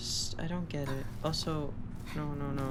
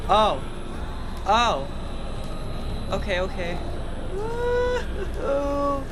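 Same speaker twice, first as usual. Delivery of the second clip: loud startled yelps; jolted cries mid-escape; being chased; 10 semitones higher, 7 dB louder, much slower than usual. Real, not acted. Chased by a monster.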